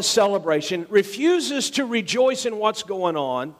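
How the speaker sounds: high, swelling sermon climax; preaching at full force. Acted. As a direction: lift them with the father's joy.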